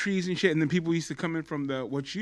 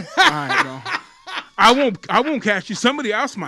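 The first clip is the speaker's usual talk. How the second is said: higher and louder, laughing, waving them through; mock guard approval; 8 semitones higher, 8 dB louder than usual. Security guard letting a hustling fan in, loud and laughing. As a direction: play the guard giving a pass with a grin. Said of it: "security guard voiced letting fan in".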